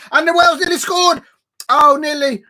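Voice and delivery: high, loud near-miss cry; nearly a goal, react with a jump; excited. Real, not acted. Near miss while watching live.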